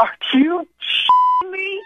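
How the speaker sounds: can't believe it, laughing; stunned disbelief. Real, not acted. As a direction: victim realizes the prank, high and slow. Victim learns it was a prank.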